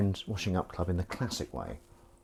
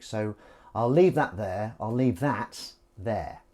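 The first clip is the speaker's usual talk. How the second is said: slow sign-off. Real, not acted. Closing sign-off.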